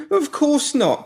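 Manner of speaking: a note of desperation creeping in; desperate. Acted. Pinky trying to convince the woman, desperate. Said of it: "a tinge of desperation".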